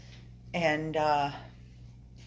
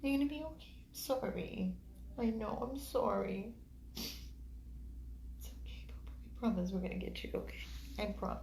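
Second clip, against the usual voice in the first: soft, higher-pitched soothing; tender apology; 6 semitones higher, 9 dB quieter than usual. Real, not acted. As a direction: softly comfort and apologise to a hurt puppy. Apologising to hurting puppy.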